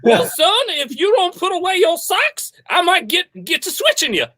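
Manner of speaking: folksy stern Texas dad; Texan dad. Acted. Coach playing a Texan father scolding his son. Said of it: "I thought you were my dad".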